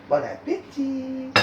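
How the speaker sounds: a gentle goodbye to a pet; tender pet talk. Real, not acted. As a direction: stretch it out sweetly for the bird. Wishing pigeon a good meal.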